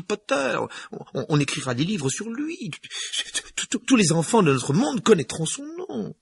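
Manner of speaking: put-on high, tense older woman; McGonagall voice. A narrator's reading.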